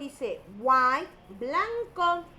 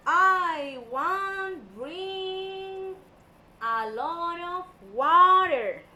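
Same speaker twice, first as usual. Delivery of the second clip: very slow, louder and higher "I want drink a lot of water"; slow demo; 4 semitones higher, 7 dB louder, much slower than usual. Shown as a demonstration. Teacher modelling a sentence for pupils, slow and loud. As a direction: stretch out every word for the class. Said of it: "For example".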